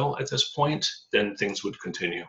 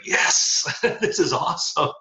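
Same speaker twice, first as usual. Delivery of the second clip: laughing delight; 7 semitones higher than usual. Retold or imitated. Retelling delight at wife's news.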